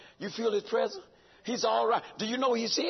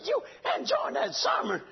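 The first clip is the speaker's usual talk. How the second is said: amazed; 11 semitones higher than usual. Retold or imitated. Preacher's amazed echo to boy.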